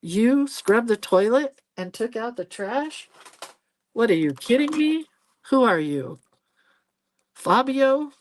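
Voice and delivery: hammed-up amazed questions; mock disbelief. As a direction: tease the hubby like he's a dreamboat. Mock disbelief at husband's chores.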